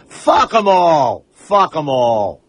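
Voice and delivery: slow, drawn-out swaggering contempt; defiant dismissal. Pretend. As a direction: stretch each word in mock bravado. Acting out his defiant new attitude.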